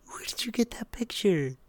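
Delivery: high, whispery disbelief; shocked whisper. Real, not acted. Streamer asks friend about a posted picture, shocked whisper. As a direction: ask in a stunned whisper.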